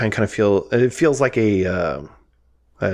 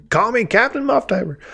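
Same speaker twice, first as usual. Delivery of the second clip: much higher, hammed-up trucker bragging; trucker impression; 12 semitones higher than usual. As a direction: crow it out like a cocky trucker. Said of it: "He's like".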